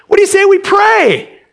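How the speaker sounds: loud suggestion. Acted. Breaking stunned silence.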